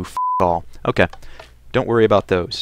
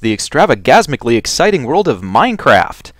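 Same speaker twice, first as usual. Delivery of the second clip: booming, higher showman announcer voice; loud carnival barker; 6 semitones higher, 7 dB louder than usual. Acted. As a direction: hype it up like a ringmaster. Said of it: "stop talking like a carnival barker".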